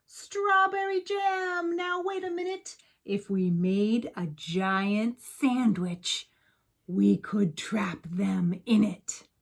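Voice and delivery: the baker bursting with his big idea; excited baker. Acted. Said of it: "baker leaped to his feet and cried".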